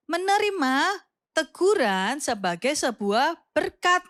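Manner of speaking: put-on slow, even, word by word for the children to repeat; teacher leads children to repeat the phrase; slow recitation. Shown as a demonstration. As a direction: recite it slowly so kids can echo. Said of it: "Ayo sama-sama katakan".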